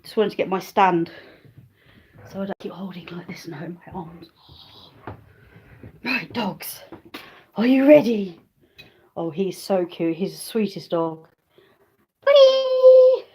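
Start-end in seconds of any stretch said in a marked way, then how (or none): 2.3-4.3 softer whispered aside; whispered under the breath. Real, not acted. Aside to the camera. 12.2-13.3 very high, loud call of the name; calling the dog. Real, not acted.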